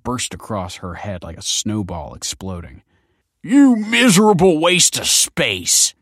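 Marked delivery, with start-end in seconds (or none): 3.4-5.9 slow, high, loud yell. Acted. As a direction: yell it with contempt. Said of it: "yelled Tony".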